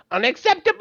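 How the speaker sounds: really high-pitched catchphrase screech; Lemongrab. Retold or imitated. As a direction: screech it in a cartoon character's voice. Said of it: "in a really high-pitched voice".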